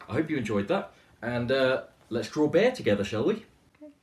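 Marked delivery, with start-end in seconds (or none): none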